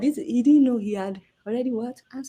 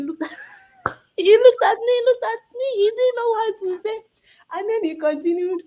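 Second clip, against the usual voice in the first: laughing while telling; 4 dB louder than usual. Real, not acted. Laughing while retelling Buhari's stare.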